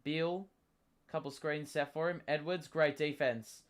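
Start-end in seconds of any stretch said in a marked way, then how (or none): none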